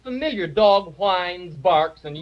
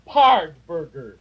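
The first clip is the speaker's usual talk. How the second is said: dog-speak word; 4 dB louder, slower than usual. Shown as a demonstration. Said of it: "Here it is".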